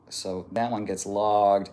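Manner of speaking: ordinary talk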